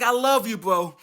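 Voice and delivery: ordinary delivery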